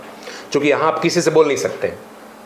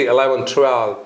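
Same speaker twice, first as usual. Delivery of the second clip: English numbers in an imitated native accent. Shown as a demonstration. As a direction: say them as native English speakers would. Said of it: "ये अंग्रेज सब ऐसे बोलते रहता है". (अंग्रेज़ों जैसा उच्चारण)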